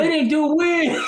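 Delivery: high, drawn-out indignant squeal; mock outrage. Someone complains about the movie skipping Wiz, in mock outrage. Quoting someone upset at omission.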